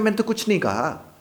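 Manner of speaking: high, rising protest with stress on तो; defensive denial. Shown as a demonstration. Accused man denies it, high and defensive. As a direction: insist you are innocent. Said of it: "उसने कहा".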